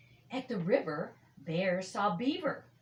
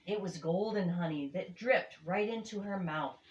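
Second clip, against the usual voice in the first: usual speaking voice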